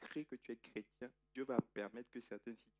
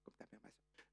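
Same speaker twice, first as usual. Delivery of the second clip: unvoiced scornful whisper; a whispered dig about his mother; whispered jab; 17 dB quieter than usual. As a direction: mutter the jab under your breath. Repeated whispered aside.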